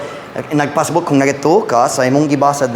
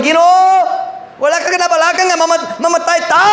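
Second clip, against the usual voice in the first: panicked disciples. Retold or imitated. Disciples begging Jesus in the storm, hammed-up panic. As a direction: cry out like sailors about to drown.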